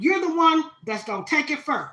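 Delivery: a proud Southern grandma urging her grandbaby on; loud, high-pitched grandma blessing; grandma's voice. Retold or imitated. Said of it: "grandma quote".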